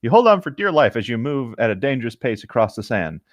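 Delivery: ordinary talk, nothing marked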